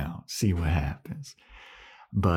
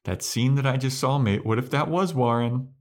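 lightly raised, higher Buffy voice, partly rushed; a hint of a higher, anxious Buffy; cautious Buffy; 7 semitones higher than usual. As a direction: plead gently but quickly, voice a little up. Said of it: "instead she's like".

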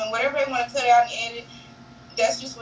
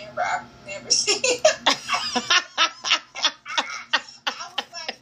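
laughing quote; 4 semitones higher than usual. Retold or imitated. Quoting friends, breaks into laughter.

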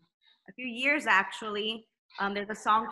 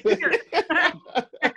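mock sarcastic okay; 7 semitones higher, 8 dB louder, much slower than usual. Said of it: "I'm like".

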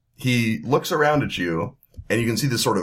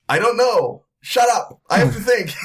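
high, loud panicked snapping; panicked shout; 12 semitones higher, 5 dB louder than usual. Acted. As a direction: yell at him to shut up, overwhelmed. Confused man shouts at buddy.

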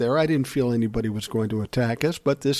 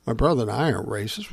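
ordinary delivery

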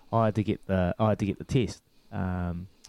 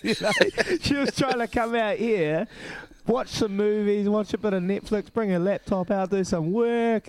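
high amused telling; 10 semitones higher than usual. Real, not acted. High voice carries on narrating.

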